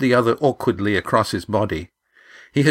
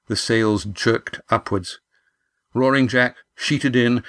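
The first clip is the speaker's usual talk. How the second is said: ordinary delivery, nothing marked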